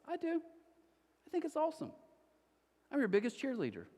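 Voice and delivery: God reassures the unnoticed believer, beaming; encouraging God. Acted. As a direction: cheer them on like a proud parent. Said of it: "God's like".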